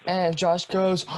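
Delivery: ordinary delivery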